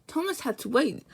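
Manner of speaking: plain reading voice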